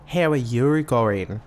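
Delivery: slow mock Australian greeting; imitated Australian accent, drawn out. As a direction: stretch the vowels in an exaggerated Aussie accent. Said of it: "They say".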